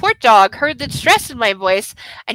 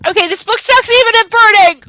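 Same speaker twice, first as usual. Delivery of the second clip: loud, higher exasperated cry; frustrated outburst; 8 semitones higher, 7 dB louder than usual. Real, not acted. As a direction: blurt it out, fed up with the book. Frustrated outburst at the burned book.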